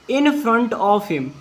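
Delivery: very slow, word by word English; slow dictation. Shown as a demonstration. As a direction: spell it out slowly for the class. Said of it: "fail on earth". (धीमे-धीमे बोलकर लिखवाना)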